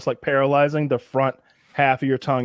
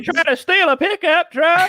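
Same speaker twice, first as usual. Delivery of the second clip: put-on high, drawn-out mountain Southern accent; slower than usual. Friend joining the accent bit, mountain Southern. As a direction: ham up a slow backwoods Southern question. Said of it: "mountain Southern there".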